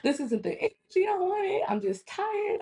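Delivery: very high-pitched wailed complaint; mock boo-hooing. Daughter upset to her mom, retold as high boo-hooing. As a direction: imitate your own tearful whine, hammed up. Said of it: "like, boo-hooing".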